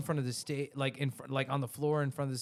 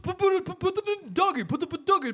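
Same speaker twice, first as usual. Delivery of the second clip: frantic high-pitched shouting, much louder; yelling owner impression; 7 dB louder than usual. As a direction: bark rushed orders in a mock frenzy. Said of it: "You do a better impression".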